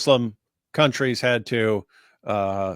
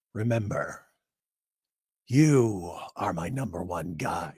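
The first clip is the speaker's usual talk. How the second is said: low, slow Joker voice; a rough attempt at the 1989 Joker; Joker impression; 6 semitones lower, 4 dB quieter, slower than usual. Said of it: "imitating Jack Nicholson's Joker".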